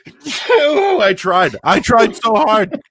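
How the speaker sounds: high, slow, strained half-sneeze voice; straining to sneeze. Real, not acted. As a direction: squeeze it out like a sneeze won't come. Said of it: "you know what you do to sneeze?".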